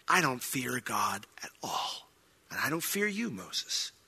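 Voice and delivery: hushed, scornful whisper to Moses; defiant whisper. Pretend. Defiant rebel whispering threat.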